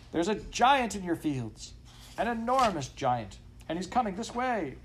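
high, urgent warning; alarmed dog. A narrator's reading. Dog warning master of giant, alarmed.

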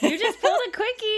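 loud, high laughing reveal; teasing laugh. Real, not acted. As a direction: crow it out with a laugh.

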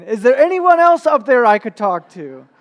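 high, very loud shout upward; shouted plea. Retold or imitated. Climber shouting up for another helper, high and very loud. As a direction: yell up the gorge, pleading for someone else. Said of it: "the man shouted up again".

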